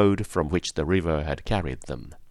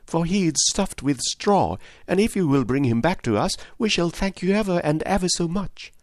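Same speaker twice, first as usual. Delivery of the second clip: put-on higher, eager pleading girl; eagerly; 9 semitones higher than usual. A narrator's reading. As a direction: beg the stork sweetly and keenly. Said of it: "said Dorothy eagerly".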